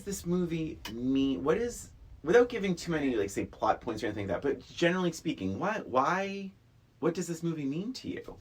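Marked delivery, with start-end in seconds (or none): none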